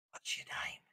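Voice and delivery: barely audible whispered question; hushed, voiceless whisper. Acted. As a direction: whisper it under your breath. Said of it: "She whispers".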